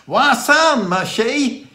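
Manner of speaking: loud, high Dorset greeting; imitated Dorset shout; Dorset accent. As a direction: holler it in broad Dorset. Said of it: "I have a Dorset accent".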